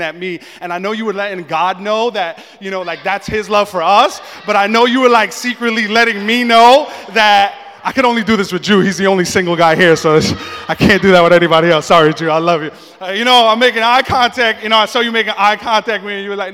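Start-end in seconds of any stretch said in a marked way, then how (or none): 4.4-16.2 excited gushing. Acted. Guy gushing over imagined church signals.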